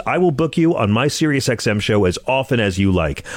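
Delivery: normal talking voice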